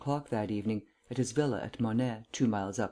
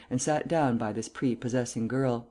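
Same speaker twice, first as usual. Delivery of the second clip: usual speaking voice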